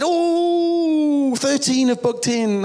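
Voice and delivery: long, high, loud "Oh", then slowing down; hammed-up excited reply, stretched out high; Pastor Daniel. Acts out Pastor Daniel's answer.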